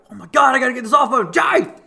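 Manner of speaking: high, loud panicked shouting at a downed friend; panicked yell. Retold or imitated. Buddy freaking out over his friend under the bike, panicked yell. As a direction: yell it like your buddy is out cold. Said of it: "he's sitting there freaking out".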